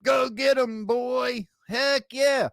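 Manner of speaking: high-pitched, loud mock cheering; mocking his mother. Mocking the mother cheering her son on, high hammed-up shout. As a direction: shriek it like a gloating mom egging her boy on. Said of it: "she came on and said".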